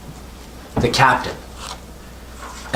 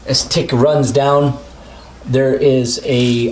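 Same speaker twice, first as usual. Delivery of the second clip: usual speaking voice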